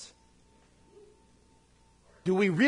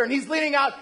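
normal talking voice

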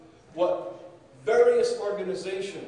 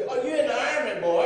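higher, louder drill-sergeant challenge; gruff soldier; 6 semitones higher, 7 dB louder than usual. Retold or imitated. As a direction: demand it like a suspicious soldier. Soldier questions him about German.

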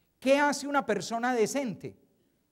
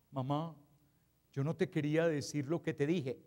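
low, soft mock-contrite apology; tail between legs; 5 semitones lower, 5 dB quieter than usual. Offender apologising to mother after hurtful words, sheepish and subdued. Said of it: "colita entre las patas".

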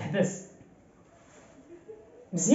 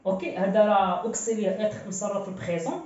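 ordinary talk, nothing marked

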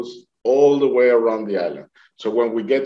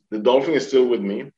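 usual speaking voice

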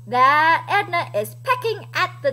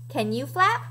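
plain reading voice

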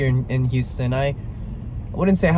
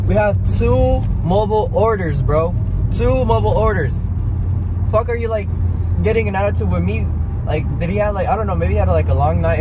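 higher, worked-up ranting; heated rant; 6 semitones higher than usual. Real, not acted. Vlogger still heated about the drive-thru, ranting higher. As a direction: vent the anger all over again. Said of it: "I got mad as fuck".

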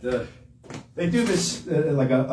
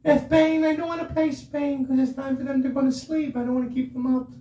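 high, sweet angel voice; little angel voice; 9 semitones higher than usual. Real, not acted. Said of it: "with my voice like this all the time".

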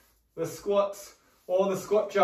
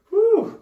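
excited whoop; 8 dB louder than usual. Real, not acted. Cheer at round end.

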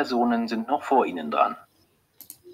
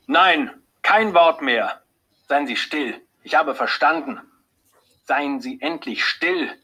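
angry; 6 semitones higher than usual. A narrator's reading. Husband silencing the doctor, slow furious outburst. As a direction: cut her off in rage, word by word. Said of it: "Er ist wütend".

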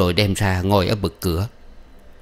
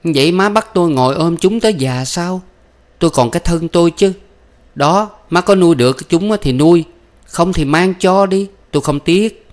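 high-pitched, cutting daughter-in-law; harsh retort; 9 semitones higher, 4 dB louder than usual. A narrator's reading. Daughter-in-law refusing to raise her children, harsh and high. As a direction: argue back sharply, voice raised.

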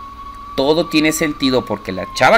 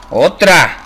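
startled cry; 4 semitones higher, 5 dB louder than usual. Real, not acted. Another scare appears on screen.

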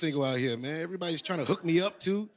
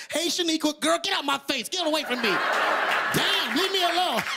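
cursing out; 10 semitones higher than usual. Retold or imitated. Shaniqua telling a coworker to leave her alone, mock cursing. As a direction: shoo her off, hammed-up. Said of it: "Shaniqua be cursing out".

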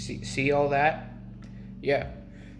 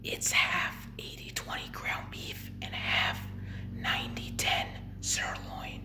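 retold whisper; 7 dB quieter than usual. Burger worker sharing the secret mix in an ear, whispered. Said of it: "he whispered in my ear".

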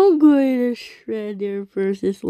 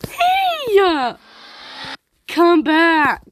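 high shout; 7 semitones higher, slower than usual. Real, not acted. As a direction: yell for help. Yelling at game character.

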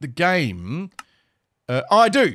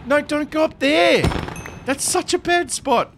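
usual speaking voice

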